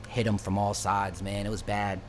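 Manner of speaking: normal talking voice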